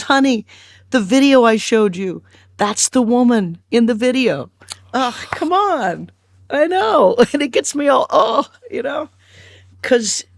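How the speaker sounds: fighting back tears as she tells it; choked up. Real, not acted. Recalling a father's words to his sick daughter, voice breaking with real emotion. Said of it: "see, I'm gonna get emotional now".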